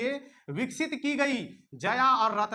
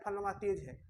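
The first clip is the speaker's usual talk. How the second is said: फलनमा तेज है said softly and offhand as people usually say it; mock the everyday remark quietly, फलनमा तेज है; quoting a common saying; 2 semitones lower, 8 dB quieter than usual. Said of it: "हम लोग क्या बोलते हैं".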